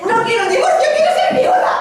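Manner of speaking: screeching high and loud; hysterical shriek. Acted. Wife screaming she'd rather be a widow. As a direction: wail it out at the top of her voice.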